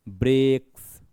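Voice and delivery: Breaks drawn out with force; heavily stressed, lengthened breaks; effortful stress. Shown as a demonstration. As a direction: put weight on breaks and stretch the vowel. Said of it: "थोड़ा जोर लग रहा है बोलने में".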